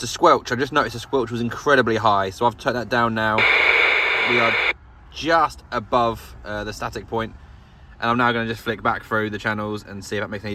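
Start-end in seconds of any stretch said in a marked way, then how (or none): none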